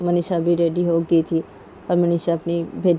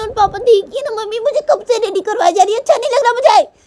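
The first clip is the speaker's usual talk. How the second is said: put-on very high whiny child, building louder; child's angry yelling; 13 semitones higher, 5 dB louder than usual. Nisha's daughter to mummy as friends wait, whiny yelling. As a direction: whine like an impatient kid, ending in a yell. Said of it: "निशा की बेटी से चिल्ला देती है".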